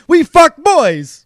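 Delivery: high, slow mock Italian voice; Italian accent. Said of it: "they're all the same impression".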